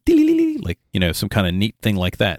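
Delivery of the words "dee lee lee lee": high, bright sung chime; crystalline tinkling. Shown as a demonstration. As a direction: sing a tiny twinkling chime. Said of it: "like, a crystalline tinkling".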